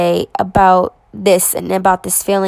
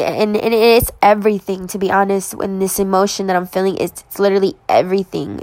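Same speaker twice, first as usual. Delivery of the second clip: usual speaking voice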